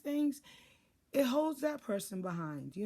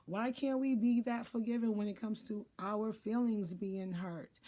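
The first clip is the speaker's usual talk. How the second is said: normal talking voice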